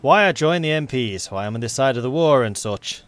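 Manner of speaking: best attempt at a Yorkshire accent; blunt, chatty Yorkshire soldier. MP telling interviewers why he joined, Yorkshire accent. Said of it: "Is that my best Yorkshire accent?".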